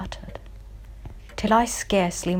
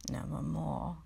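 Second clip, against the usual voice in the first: softer, low final raven answer; raven's reply; 3 semitones lower, 9 dB quieter than usual. Acted. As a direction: let the bird answer hushed and final.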